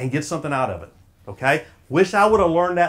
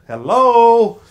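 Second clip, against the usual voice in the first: loud, high, falling hello; loud prodding hello; 5 semitones higher, 8 dB louder than usual. Real, not acted. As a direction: call out to wake up the room. Prodding an unresponsive audience.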